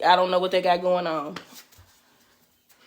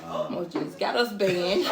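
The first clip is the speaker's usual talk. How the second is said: usual speaking voice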